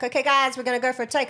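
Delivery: high, loud call to the cast; imitated crew call. Crew calling actors for a take, loud call.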